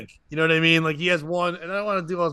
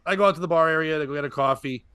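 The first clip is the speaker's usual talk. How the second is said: usual speaking voice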